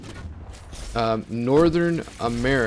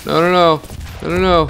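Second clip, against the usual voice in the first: louder, higher string of repeated noes; urgent protest; 5 semitones higher, 4 dB louder than usual. Real, not acted. Player caught out while popping out, urgent raised protest. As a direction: cry out in sudden alarm at the game. Reacting under attack in game.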